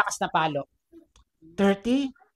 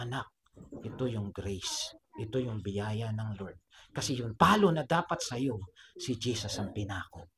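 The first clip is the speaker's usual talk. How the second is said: tender teacher; 9 semitones lower, 6 dB quieter than usual. Acted. Teacher explaining grace to the weeping child, hushed and tender. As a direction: lower it almost to a whisper, solemn and tender. Teacher gently concludes amid tears.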